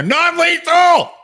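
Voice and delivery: very loud, high, slow shout; shouted reassurance. Acted. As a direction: bellow it out while raising an axe.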